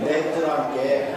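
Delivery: slow, laughing Jeolla "냅두란께"; Jeolla dialect. Shown as a demonstration. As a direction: drag out "냅두란께" like a Jeolla local. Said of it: "전라도 말로 뭐라고 그러나요".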